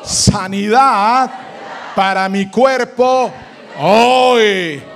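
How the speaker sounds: shouted, slow, high declaration of healing; preaching at full force. Acted. Preacher to congregation, repeat after me, full force. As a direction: proclaim it to the back row.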